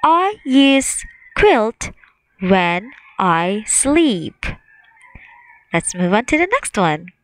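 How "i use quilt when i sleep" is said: very slow, word by word; slow choral read. Shown as a demonstration. Teacher leading the class slowly through the sentence. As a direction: drag it out for the class to follow. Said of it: "Let's all say it together".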